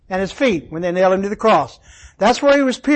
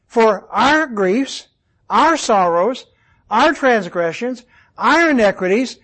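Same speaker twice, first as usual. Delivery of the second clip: each "our" stressed, every item ending on a rise. Shown as a demonstration. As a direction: build a measured list on a repeated, weighted "our". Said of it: "the emphasis is on the plurals".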